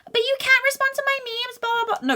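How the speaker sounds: high, far nagging friend voice; mocking whiny friend.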